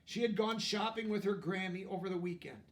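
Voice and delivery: usual speaking voice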